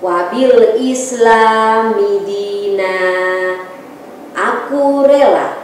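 strong, rhythmic recited pledge; declamatory pledge. Acted. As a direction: proclaim each line firmly. Leads pledge and prayer.

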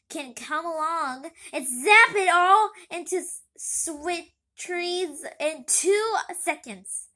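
loud, high, slow complaining rant; bitter rant. Acted. Pity rants to his son about wasted work, loud and high. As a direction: rant at how unfair it is.

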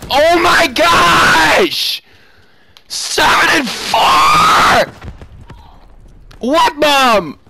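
high, loud drawn-out screams; raging shout. Real, not acted. Gamer raging at seven and four, outraged shouting. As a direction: scream in exasperated disbelief.

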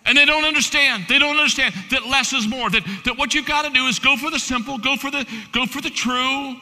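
loud, high, fervent sermon delivery; preaching at full force. Acted. A preacher urging his church toward simple faith, loud and fervent. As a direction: drive the point home to the congregation.

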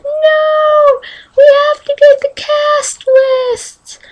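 mock panicked wail. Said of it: "I was freaking out".

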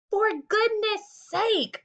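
high, slow exasperated bellow; drenched farmer bellowing at the hired man. Acted. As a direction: let it out, soaked and fed up. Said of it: "bellowed Mister Zuckerman".

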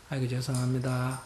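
soft, meek "아이고, 죄송합니다"; wife softly apologizing to her husband; humble apology. Shown as a demonstration. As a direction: give in gently, head bowed. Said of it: "이렇게 말해줘야 돼".

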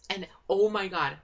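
loud excited exclamation; real excited oh my God. Real, not acted. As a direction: burst out with excitement. Excited about the episode.

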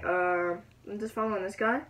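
ordinary talk, nothing marked

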